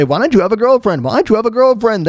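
usual speaking voice

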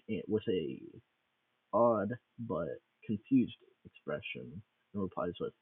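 usual speaking voice

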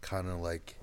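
low, soft voice sinking away; near whisper. Real, not acted. Co-host explaining the early lack of schedule, near whisper. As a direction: let the voice drop low and hushed. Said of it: "You're whispering".

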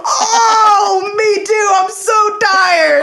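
high, loud whiny mock tiredness through laughter; mock tired empathy. Mimicking a yawning friend, high whiny laughing mock sob. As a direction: whimper how tired you are, laughing. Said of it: "I'm like".

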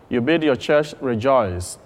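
ordinary talk

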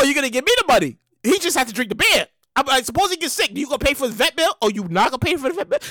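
ordinary talk

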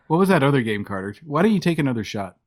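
ordinary talk, nothing marked

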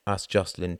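plain reading voice

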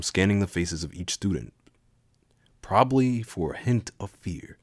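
ordinary talk

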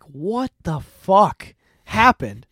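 slow, drawn-out demand as the wife; incredulous demand. Retold or imitated. Wife picking him up from jail, slow incredulous demand. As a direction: space the words out in disbelief.

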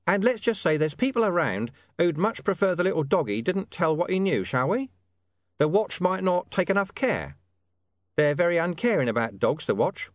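high, cagey informant voice. Acted. Hidden informant hinting to William about the dog, high and cagey. Deep Bone hints further.

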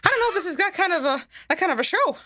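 high, fast cartoon mouse dodging; Mickey Mouse voice. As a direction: nervously laugh it off as Mickey.

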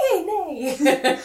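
high, laughing whinny on the words; mock horse nicker. Footman in horse costume neighing at Danielle, laughing whinny. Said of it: "makes a, like, a nickering sound".

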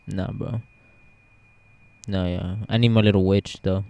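normal talking voice

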